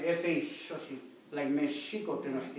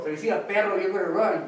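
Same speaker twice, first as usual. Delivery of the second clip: loud, higher joking call; raised voice, kidding the crowd; playful loud warning; 3 semitones higher, 8 dB louder than usual. Real, not acted. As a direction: call out a joke warning to the group. Joking warning shouted to the group.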